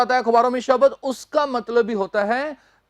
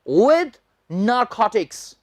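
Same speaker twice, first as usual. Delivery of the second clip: slow; much slower than usual. Shown as a demonstration. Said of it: "teacher reads headline slowly".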